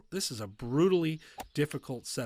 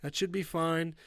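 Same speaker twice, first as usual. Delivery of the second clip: ordinary delivery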